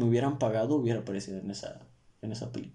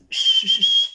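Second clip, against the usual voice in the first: shushing; 8 dB louder, slower than usual. Real, not acted. Hushing his dog.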